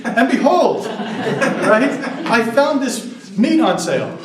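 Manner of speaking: laughing, high and loud, like a herald; mock grand proclamation. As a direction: announce a grocery bargain as biblical news, grinning. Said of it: "I wish we would go around saying".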